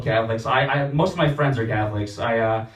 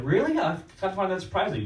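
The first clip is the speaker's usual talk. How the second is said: mock surprise; 8 semitones higher than usual.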